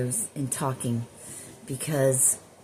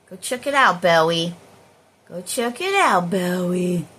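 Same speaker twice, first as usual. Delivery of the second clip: raised, louder call, dropping on the name; calling to dog; 6 dB louder than usual. Real, not acted. Owner sending Bowie to check out growling, calling out.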